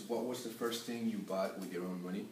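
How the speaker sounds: normal talking voice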